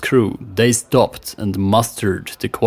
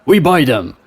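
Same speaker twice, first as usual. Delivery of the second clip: eager; 5 semitones higher, 5 dB louder than usual. Acted. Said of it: "said in a heartbeat".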